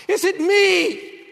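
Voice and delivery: high, loud, slow plea of self-doubt; anguished cry. Acted. Preacher crying out to the congregation, anguished plea. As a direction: cry it out, stretched and pleading.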